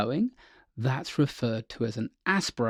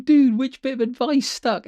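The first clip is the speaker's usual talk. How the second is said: excited question; 11 semitones higher, 6 dB louder than usual. Retold or imitated. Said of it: "And I said to him".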